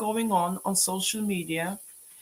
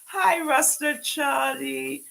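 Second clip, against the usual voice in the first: drawn-out greeting; 8 semitones higher, much slower than usual. Real, not acted. Greeting a returning viewer.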